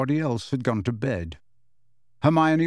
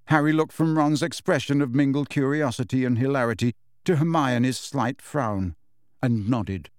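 ordinary delivery

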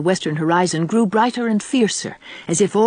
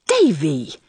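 reproachful. A narrator's reading. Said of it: "she exclaimed reproachfully".

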